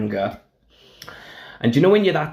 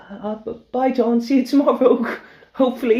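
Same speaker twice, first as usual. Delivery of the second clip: terrified goodbye; 13 semitones higher than usual. Retold or imitated. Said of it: "and I was like".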